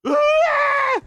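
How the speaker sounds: big burst of shrieking laughter; cracking up hard. Real, not acted. Laughing at the scenario.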